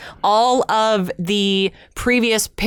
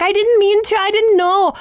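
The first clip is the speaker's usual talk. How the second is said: high, loud mock sob; imitating Merida crying; 12 semitones higher, 4 dB louder than usual. Merida crying to her mother, high loud mock sob. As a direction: whimper it like a distraught princess. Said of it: "she's like".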